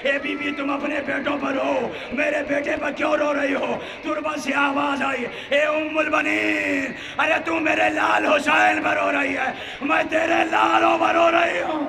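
grieving mother voices, shouted and sung; wailing shout. Acted. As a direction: cry it out to the mourners. Mourning voices shouted in majlis.

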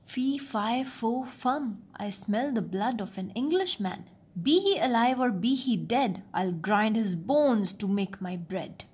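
ordinary delivery

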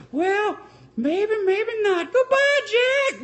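sing-song a cold goodbye; mock Rose. Rose bidding Jack goodbye from the door, in high falsetto.

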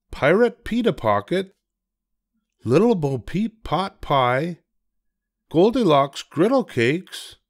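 put-on a dad making the kids' menu sound fun; lightly voiced father reading. Father reading the kiddie menu to DW, lightly playful.

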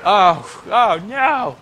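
loud, high cry of panic; panicked shout. Real, not acted. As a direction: yelp in fright. Enemy attack.

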